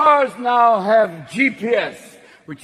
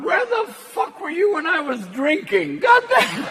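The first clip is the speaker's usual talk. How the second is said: higher, whiny half-sob; wailing complaint; 8 semitones higher than usual. Retold or imitated. Driver yelling at the self-parking car, whiny. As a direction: whine it like a betrayed drunk. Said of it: "I'm going".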